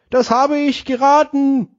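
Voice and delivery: joke voice. Acted. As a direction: play the puzzled gentleman, slowly. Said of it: "Er antwortet". (hohe, langsame Witzstimme)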